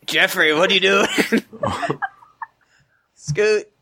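ordinary talk

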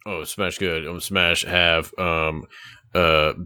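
low, slow caveman talk; dumb barbarian voice. Acted. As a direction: play a simple-minded brute, low and slow. Smash, barbarian character voice.

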